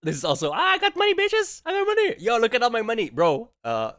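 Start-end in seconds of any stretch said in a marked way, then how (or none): 0.5-3.1 much higher, louder mock brag; mock money bragger. Mocking the host bragging about his money, high mock brag. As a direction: crow about cash like a show-off.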